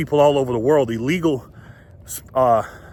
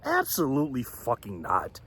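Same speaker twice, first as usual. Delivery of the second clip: usual speaking voice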